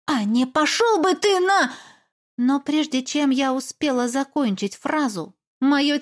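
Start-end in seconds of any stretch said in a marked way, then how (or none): 0.0-1.7 high, defiant curse cut off; angry retort. Acted. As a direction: snap it back at him. Heroine swears at him.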